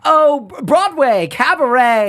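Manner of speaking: high, loud Broadway flourish; a bright theatrical proclamation; showy announcement. Acted. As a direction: sell it like a cabaret marquee. Showy reply to Marsha.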